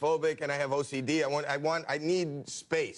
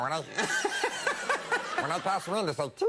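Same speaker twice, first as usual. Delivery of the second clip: helium voice; 12 semitones higher than usual. Retold or imitated. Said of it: "terrible helium accident".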